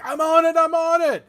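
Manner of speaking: high, loud shout from the back. Acted. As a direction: yell it back over the noise. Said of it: "she shouts in back".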